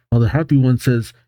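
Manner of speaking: usual speaking voice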